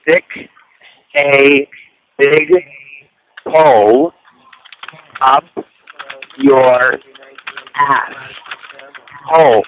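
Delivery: each word spaced out with long pauses; slow, word by word. Shown as a demonstration. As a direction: spell the insult out slowly for the typist. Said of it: "please repeat the f-, first part".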